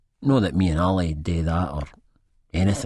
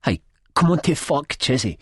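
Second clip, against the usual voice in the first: higher-pitched protest; finding his voice; 7 semitones higher than usual. A narrator's reading. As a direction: speak up to object. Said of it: "I find my voice at that".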